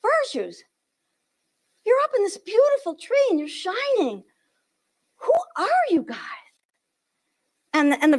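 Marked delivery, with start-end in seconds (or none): 0.0-6.2 high, wondering voice of the prophets calling up; high awestruck prophets; prophets marvelling. Acted. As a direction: look up in awe at the shining virtues. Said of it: "and they say".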